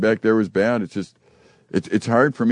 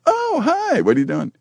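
cheery greeting. Retold or imitated. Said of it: "stop and go".

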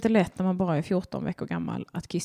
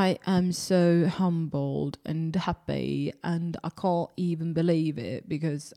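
mock acceptance speech; 2 semitones lower, slower than usual.

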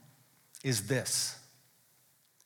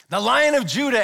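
loud, higher-pitched proclamation; awed exclamation; 5 semitones higher, 5 dB louder than usual. Acted. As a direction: announce it as thrilling news. Exclaimed announcement of the promised Lion.

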